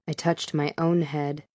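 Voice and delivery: ordinary delivery, nothing marked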